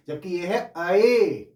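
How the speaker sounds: "ऐ" slowed, with the full open a-to-i glide; correct pronunciation. Shown as a demonstration. Teacher corrects students' habit of saying it as a long e. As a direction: set "ऐ" apart from the flat "बड़ा ए". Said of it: "आपके हिसाब से बड़ा ए".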